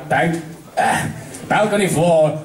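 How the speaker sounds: ordinary delivery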